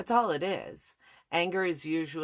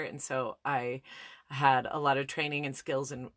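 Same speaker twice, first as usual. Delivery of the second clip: ordinary delivery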